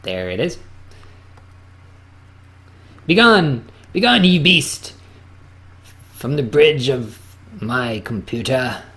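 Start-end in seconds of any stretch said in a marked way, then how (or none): 3.1-8.8 loud, slow, high theatrical command; dramatic banishing. Acted. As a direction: cast out the beast like a wizard. Commanding the program to close.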